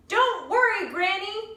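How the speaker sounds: high, loud call to the back seat; yelled reassurance; shouting. Acted. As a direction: shout it over the engine noise. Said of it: "I shouted at her".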